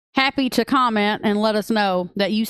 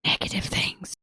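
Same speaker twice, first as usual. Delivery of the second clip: hushed; 8 dB quieter than usual. Real, not acted. Delicately hinting at rude remarks.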